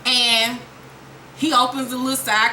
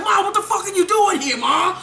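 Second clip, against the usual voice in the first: higher, incredulous son; shocked son; 8 semitones higher than usual. Retold or imitated. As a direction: ask it high in disbelief. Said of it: "he's like".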